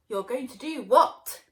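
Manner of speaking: loud teasing ask; loud cheeky challenge; mocking question. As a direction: tease the villain. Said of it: "asked Sweet Potato".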